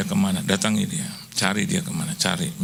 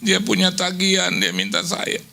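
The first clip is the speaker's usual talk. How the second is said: mock prayer; 9 semitones higher than usual. Quoted prayer to God.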